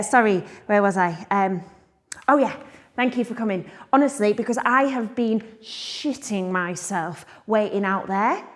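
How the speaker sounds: ordinary talk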